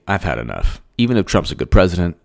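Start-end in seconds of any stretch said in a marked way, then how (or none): 0.1-0.8 much lower, softer, resigned; mock weary voter. Imitating a worn-out average American, low and quiet. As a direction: sigh it out defeated. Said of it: "to say".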